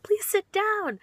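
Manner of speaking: high, loud mock exasperated cry; exclaimed plea. Teacher to kindergartners crowding round her, mock desperate exclamation. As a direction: cry it out over a roomful of kids. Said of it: "at some point just, like, exclaimed".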